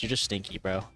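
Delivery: ordinary talk, nothing marked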